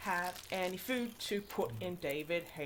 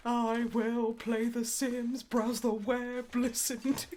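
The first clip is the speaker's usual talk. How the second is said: higher, slow, sing-song plans; goofy character voice; 5 semitones higher, slower than usual. Pretend. Narrating the game character's plans, goofy voice. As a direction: play a cheery dumb avatar.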